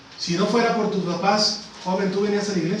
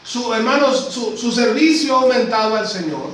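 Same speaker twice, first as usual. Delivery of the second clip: rising, insistent pulpit cadence, pressing each phrase; preaching at full force; 6 semitones higher, 4 dB louder than usual. Acted. Preacher urging the congregation, at full preaching force. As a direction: preach it hard, driving every line home.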